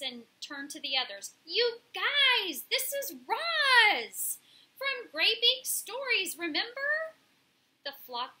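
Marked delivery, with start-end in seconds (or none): 1.4-7.2 high, loud, stretched-out announcement; excited pigeon. A narrator's reading. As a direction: call out to the flock, thrilled. Pigeon excitedly tells the flock.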